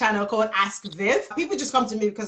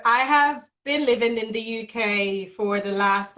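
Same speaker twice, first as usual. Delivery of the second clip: normal talking voice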